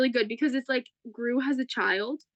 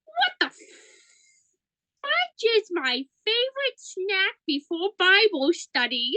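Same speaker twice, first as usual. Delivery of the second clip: imitated little kid; 8 semitones higher than usual. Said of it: "and the kid goes".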